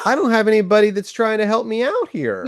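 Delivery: high-pitched, jokey exasperation; imitated protest. Job complains to his unhelpful friend, in imitated indignant protest. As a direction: voice Job's complaint in comic indignation. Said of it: "And he's like".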